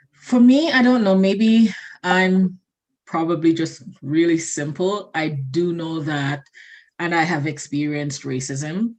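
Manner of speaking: ordinary talk, nothing marked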